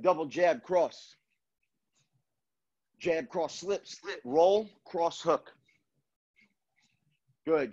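punchy combo calls between pauses; boxing coach calling combos. Acted. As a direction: call each combo crisply, like a coach. Live combo calling in class.

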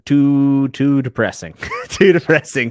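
too depressing, loud and laughing; loud amused complaint; loud laughing groan. Real, not acted. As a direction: wail it through a laugh. Mock despair about his looks.

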